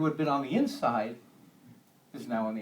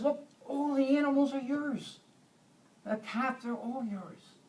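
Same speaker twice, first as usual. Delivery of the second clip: gentle reassurance; 9 semitones higher than usual. Acted. Father gently reassuring older son.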